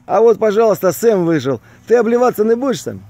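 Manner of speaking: ordinary talk, nothing marked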